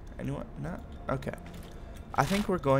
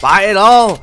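loud exclamation; 5 semitones higher, 13 dB louder than usual. Real, not acted. Excited purchase.

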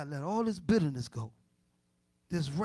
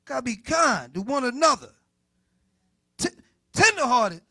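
loud, high preaching with pained outbursts; loud exasperated sermon; full-force preaching; 9 semitones higher, 11 dB louder than usual. Acted. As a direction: wrestle aloud with the verse.

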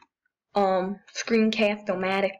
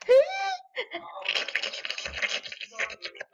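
high airy wheezing laugh; breathless giggle; 4 dB quieter than usual. Real, not acted.

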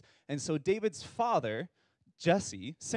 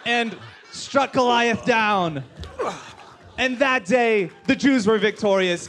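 excited climax; 5 semitones higher, 7 dB louder than usual. Acted. Building story climax.

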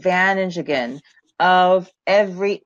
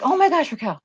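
high, bubbly excited retelling of a client's news; excited friend; 4 semitones higher than usual. Retold or imitated. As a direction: gush like an excited young coach.

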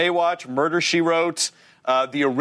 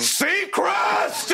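high, explosive yell of a name; mock shout; 13 semitones higher than usual. Host jokingly bellows at his guest, mock shout. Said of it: "I feel like I shout that".

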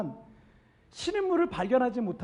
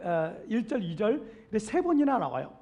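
ordinary talk, nothing marked

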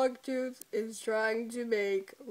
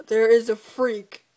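much louder, a comic bark; mock shout; 13 dB louder than usual. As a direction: yell it out for laughs. Acted shout at Longtooth.

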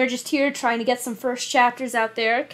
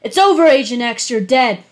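loud, raised threat across the room; threatening call; 8 dB louder than usual. Acted. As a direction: shout it at your target. Sniper threatens the hero.

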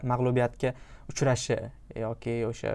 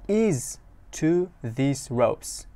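very slow, stretched phrase; careful slow read; slow lyric reading; much slower than usual. Shown as a demonstration. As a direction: read it slowly for learners. Teacher reads slowly.

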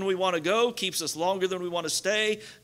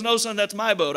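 lightly acted grown-up correction, near the usual pitch; store owner's rebuttal; 2 semitones higher than usual. Toy store owner to the boy claiming the boat, lightly acted firm denial. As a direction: correct the child firmly without getting excited. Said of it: "He goes".

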